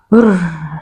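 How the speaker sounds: long breath out; re-enacted relieved exhale; sigh of relief. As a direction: let the strain go in one breath. Relief once baby is out.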